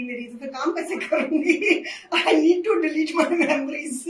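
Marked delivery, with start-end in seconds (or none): 2.0-4.1 laughing through the words, slowing right down. Real, not acted. Host laughing to viewers about forgetting yesterday's show, laughing slowly. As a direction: giggle it out slowly at yourself.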